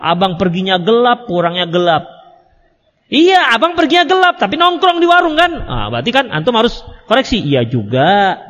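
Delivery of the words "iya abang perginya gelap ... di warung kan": higher, teasing retort in the wife's voice; sarcastic wife. Acted. Wife mocking her husband's excuse, teasing higher voice. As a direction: scoff back at him like a doubting wife.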